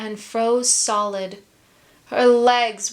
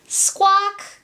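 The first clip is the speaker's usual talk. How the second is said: high sad seabird squawk; gull squawk; 11 semitones higher than usual. Acted. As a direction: squawk mournfully. Gull's sad cry.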